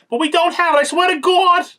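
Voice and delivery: put-on loud, very high New York accent screech; over-the-top New York accent. Wife swearing to the villain, shrieked New York accent. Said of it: "that kind of New York accent".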